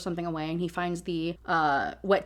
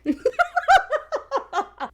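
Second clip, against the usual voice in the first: big laugh; 5 dB louder than usual. Real, not acted. Laughing at the line again.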